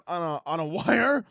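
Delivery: ordinary talk, nothing marked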